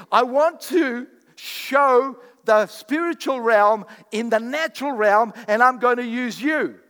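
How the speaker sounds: loud, slow, ringing sermon delivery; full-force preaching. Acted. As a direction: preach it to the back row.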